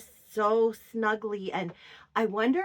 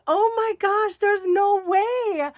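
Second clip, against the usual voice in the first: excited disbelief; 11 semitones higher, 6 dB louder than usual. Retold or imitated. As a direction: cry out that it can't be true. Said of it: "I'm like".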